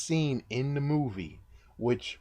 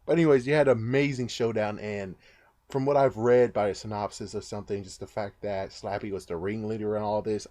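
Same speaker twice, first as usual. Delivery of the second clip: normal talking voice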